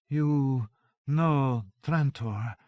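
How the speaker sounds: very slow, drawn-out droning. A narrator's reading. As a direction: drone each word out slowly. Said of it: "Joan droned".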